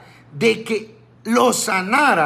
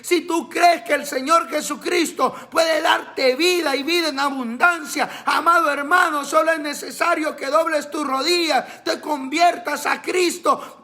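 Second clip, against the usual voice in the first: higher, shouting sermon delivery; fiery preaching; 7 semitones higher than usual. Acted. Pastor exhorting his brothers in faith, fiery higher shout. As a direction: preach it with fire, calling out to the faithful.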